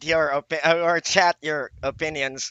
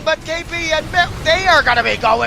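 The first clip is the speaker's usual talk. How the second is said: building from eager to a full excited shout, higher and louder; rising hype call; 10 semitones higher, 7 dB louder, faster than usual. Acted. Caster calling the final push to viewers, building to an excited shout. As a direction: climb into a roaring game-winning call.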